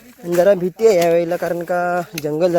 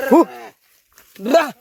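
calling out; 8 semitones higher, slower than usual. Real, not acted. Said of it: "आवाज देत आलो".

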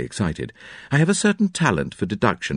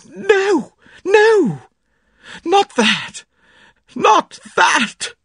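very high, loud terrified cry; sudden cry; 6 dB louder, much slower than usual. A narrator's reading. Mary crying out at the poison suspicion, terrified high cry. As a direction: shriek it out in horror. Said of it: "with a sudden cry that startled me".

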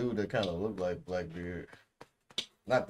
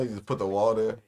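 ordinary delivery

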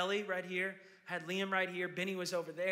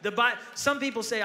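ordinary talk

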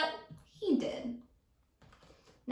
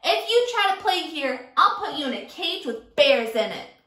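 bully threat; 2 semitones higher, 5 dB louder than usual. Acted. Teacher as Sammy, loudly threatening Kevin. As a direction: menace him like a playground bully.

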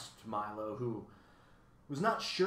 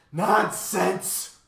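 booming outraged cry, much higher and louder; bellowing; 8 semitones higher, 12 dB louder than usual. A narrator's reading. Math magician bellows at Milo, booming. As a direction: bellow it at the boy. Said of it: "bellowed the math magician".

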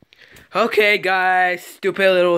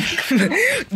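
high giggle; 9 semitones higher than usual. Real, not acted. Laughs during the quarrel.

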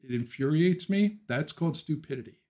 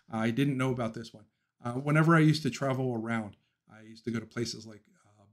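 ordinary talk, nothing marked

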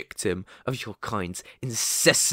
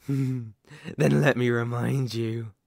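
ordinary talk, nothing marked

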